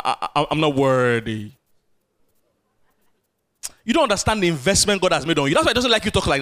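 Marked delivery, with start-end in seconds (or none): 0.0-1.5 lower, stammering, lightly voiced self-pity; mock self-pity. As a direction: whimper unworthiness in jest. Mimicking self-pitying believer.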